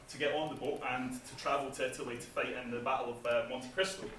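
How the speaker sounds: ordinary talk, nothing marked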